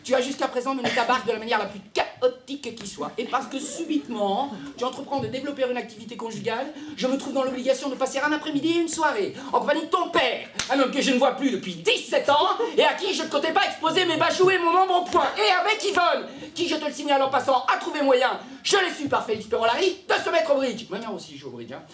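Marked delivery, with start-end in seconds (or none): 0.0-15.3 indignant mother. Acted. Mother's indignant tirade on the phone. 15.4-20.9 high, forceful tirade about a rival; outraged mother. Acted.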